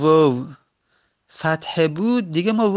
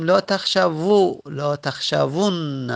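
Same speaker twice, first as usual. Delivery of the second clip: slow demo; slower than usual. Shown as a demonstration. Teacher demonstrates the final form.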